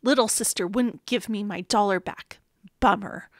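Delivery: ordinary delivery, nothing marked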